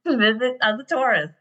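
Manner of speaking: ordinary talk, nothing marked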